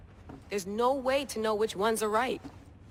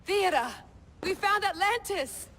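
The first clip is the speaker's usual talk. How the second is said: excited shout; 10 semitones higher than usual. Acted. Calling to Theodore about finding Atlantis, thrilled shout. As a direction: burst out with the big news.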